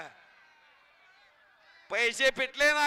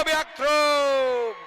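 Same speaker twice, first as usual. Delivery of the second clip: drawn-out call; 8 dB louder, much slower than usual. Real, not acted. Commentator announcing the move to the crowd, long drawn call. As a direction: stretch out "back throw" slowly, falling at the end.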